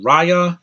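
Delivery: emphatic corrected "Raya", rhyming with Maya; correct pronunciation. Shown as a demonstration. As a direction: punch "Raya" with a long "eye" vowel. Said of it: "they're saying it wrong".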